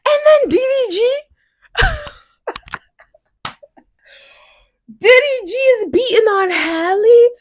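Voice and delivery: very high, squeaky incredulous question; can't believe what she's hearing; shocked disbelief. Real, not acted. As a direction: screech the name in disbelief. Shocked at news about [name].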